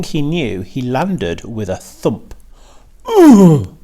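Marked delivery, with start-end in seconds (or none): 3.1-3.8 loud high grunt on landing; a loud pained grunt. A narrator's reading. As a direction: grunt as the pig hits the ground with a thump. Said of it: "he grunted".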